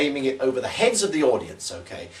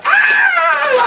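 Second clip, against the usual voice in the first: sudden high shout to scare the audience; demo shout; 10 dB louder than usual. Shown as a demonstration. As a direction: yell out big as the snakes fly. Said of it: "and I go".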